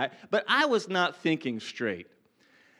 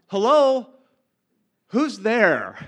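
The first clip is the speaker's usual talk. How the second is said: calling out; 3 semitones higher, 8 dB louder, slower than usual. Retold or imitated. Alone at the reservoir, calling out loud to nobody. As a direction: shout it out to an unseen stranger. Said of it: "I began to call out".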